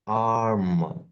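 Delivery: ஆர்ம் said slowly with a long aa; sounding out arm. Shown as a demonstration. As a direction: model ஆர்ம் carefully. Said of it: "இதை எப்படி read பண்ணலாம்". (ஆர்ம் என்று தெளிவாக உச்சரித்தல்)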